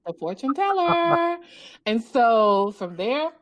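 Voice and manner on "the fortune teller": high, drawn-out teasing echo; playful echo. Pretend. Author echoing the host's joke, playful. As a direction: stretch it out high, in mock wonder.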